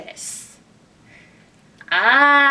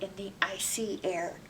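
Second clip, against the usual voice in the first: whisper; 3 semitones lower, 11 dB quieter than usual. A narrator's reading. Whispered end of sentence.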